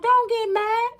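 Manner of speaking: high mock Karen voice; squeaky entitled Karen. Karen scolding you in a parking lot, high and mock-prim.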